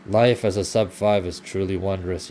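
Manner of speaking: mock praise, deadpan and slow; bitter irony. Real, not acted. Bitter ironic remark.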